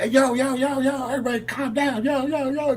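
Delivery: high-pitched retold call to calm everyone; hailing a rowdy room to settle down; calling out over crowd. 'cause everybody was screaming.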